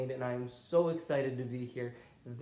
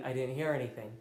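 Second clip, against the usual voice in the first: normal talking voice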